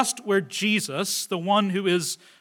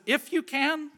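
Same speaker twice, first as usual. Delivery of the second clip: higher echo of "If you can," lifted at "can"; quoting back; 6 semitones higher, 5 dB quieter than usual. Acted. Jesus quotes the man's words back to him, higher echo. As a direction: hand "If you can" back with raised pitch. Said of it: "He quotes the man's words back to him".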